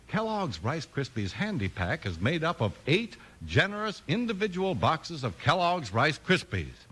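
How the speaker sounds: ordinary talk, nothing marked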